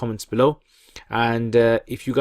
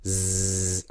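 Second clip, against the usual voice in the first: a long buzzing Zzz like an electric shaver; 4 semitones lower, 4 dB quieter than usual. Shown as a demonstration. Teacher imitating an electric shaver's buzz. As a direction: hold the buzz like a shaver humming. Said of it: "If you know those electric shavers".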